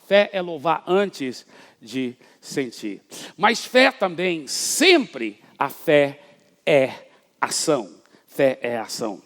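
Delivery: slow, emphatic, stretched preaching lines; preacher proclaiming that faith is action. Real, not acted. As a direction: lean on every word. Preaching at full force.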